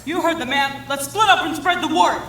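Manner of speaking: loud, high rallying call; rousing orders. Acted. Strike leader telling the newsies to spread the word, rousing. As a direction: send the troops out loudly.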